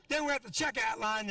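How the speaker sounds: ordinary talk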